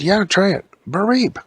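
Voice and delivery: high, loud character voice ending in a squeaky beep; eager urging. Acted. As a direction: egg them on, high and loud. Character urges trying the jump scare.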